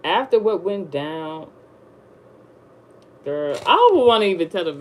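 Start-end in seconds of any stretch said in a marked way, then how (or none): none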